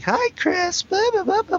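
high, chirpy imitation of excited girls; mock girly chatter. Mocking the girls who leaned over the seat in a high chirpy voice. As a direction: ham up the giggly girls.

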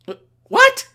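high, stammering outraged squeak; indignant disbelief. Acted. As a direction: sputter in shocked disbelief. Reacting to friendly ship threatening.

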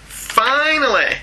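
finally stretched high and slow; dramatic drawn-out finally. Acted. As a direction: savour it like a big reveal.

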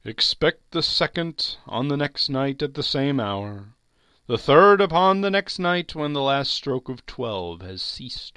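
ghostly prophecy in a high, carrying voice; warning ghost. Acted. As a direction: intone a solemn farewell warning, drawing out words. Ghost gives parting warning.

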